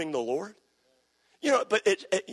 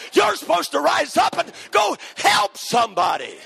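higher and much louder, shouting sermon; full-force preaching; 10 semitones higher, 7 dB louder than usual. Acted. Preacher rousing the congregation, shouting at full force. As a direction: preach it up to a roaring climax.

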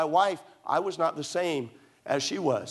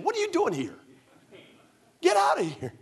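high, put-on scolding old lady voice; scolding old saint; 12 semitones higher than usual. Hospital patient scolding her pastor, in a high mock-scolding voice. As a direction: shoo the young preacher out, half mocking. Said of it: "chewed out by a precious saint saying".

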